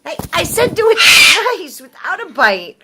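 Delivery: half-laughing, loud scolding shout. Real, not acted. Bird keeps biting, she repeats.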